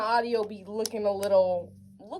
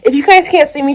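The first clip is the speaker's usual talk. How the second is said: ordinary delivery, nothing marked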